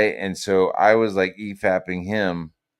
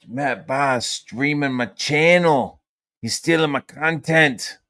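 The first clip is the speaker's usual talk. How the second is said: drawn-out, slightly raised mock Aussie whine; half-hearted Aussie accent; 5 semitones higher, 3 dB louder, slower than usual. Streamer mimics a rival complaining about his channel, half-hearted Aussie accent. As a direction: imitate him in a weak, stretched Aussie accent. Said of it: "don't even have the strength to do a fucking, uh, Aussie accent".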